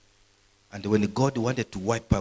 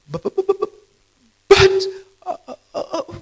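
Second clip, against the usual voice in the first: high, loud stuttering "but, but, but"; a hammed-up stammer breaking into a laugh; stammering Moses; 10 dB louder, faster than usual. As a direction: stutter it out like Moses before God. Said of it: "Moses was a stammerer".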